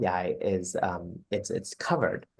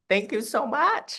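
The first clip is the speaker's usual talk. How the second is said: high, drawn-out affectionate thanks; sing-song and slow, full of affection; gushing thanks; 12 semitones higher than usual. Real, not acted. Host thanking her guest as they wrap up, gushing.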